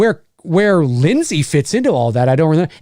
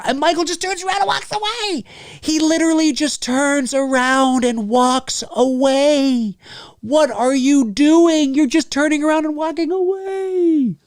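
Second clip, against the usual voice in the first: incredulous; 9 semitones higher than usual. Real, not acted. Streamer lamenting Michael just walking away, incredulous.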